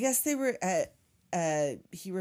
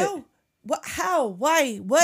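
louder rising disbelief questions; incredulous; 7 semitones higher, 8 dB louder, slower than usual. Real, not acted. Reacting to the plan, loud disbelief. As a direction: pile on the outrage, each louder.

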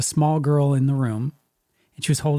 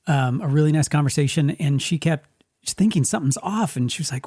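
usual speaking voice

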